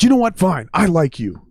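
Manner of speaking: sudden high, loud switch to warmth; mock gruff driver suddenly friendly; gruff driver voiced. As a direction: play the grumpy driver caving in. Cab driver voiced in story, sudden change.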